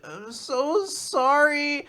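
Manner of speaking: high, slow little-girl sob, lightly played; mock sobbing girl. Sick little girl apologising to her doctor, mock sobbing. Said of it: "And she's sobbing".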